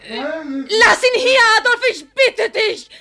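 high, loud, slow, begging cry; tearful pleading. Acted. Daughter begs her brother to leave the father, tearful. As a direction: plead through tears.

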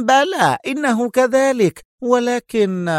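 very high, mocking concession; high-pitched ironic agreement; sarcastic. A narrator's reading. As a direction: agree with lofty sarcasm, leaving it hanging. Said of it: "قال المفتش في سخرية".

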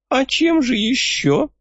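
put-on high, slow sly old codger; a crafty old man pleased with himself. A narrator's reading.

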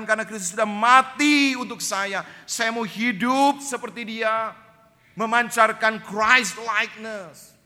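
high, loud sermon push; impassioned preaching. Acted. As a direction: preach it full force to the church. Preaching at full force. (khotbah berapi-api, tinggi dan keras)

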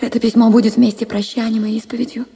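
plain reading voice

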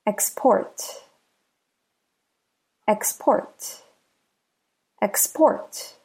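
"Export" as the verb, ex-PORT; stress on last syllable. Shown as a demonstration. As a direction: say "Export" with a light EX, beat on PORT. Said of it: "The last syllable is stressed".

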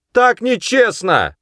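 high, loud, drawn-out protest; shouted protest. A narrator's reading. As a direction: call back indignantly from afar. Boy shouts back across river. (протяжный возмущённый крик)

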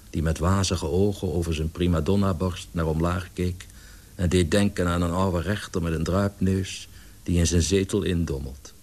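ordinary delivery, nothing marked

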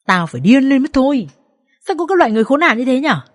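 high, loud, fast indignation; outraged, rushing rant; furious outburst. A narrator's reading. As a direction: rage on a friend's behalf. Friend rages at the husband.

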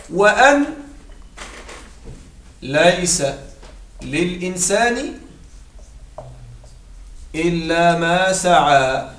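slow, drawn-out chanted recitation of the verse; Quranic recitation. Shown as a demonstration. Teacher reciting a Quran verse to students, slow and drawn out. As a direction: recite the verse slowly, word by word.

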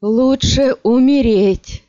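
высокий, громкий и медленный крик; умоляет в отчаянии, растягивая слова; отчаянный крик. A narrator's reading. Раненый умоляет дать умереть. (отчаянная мольба)

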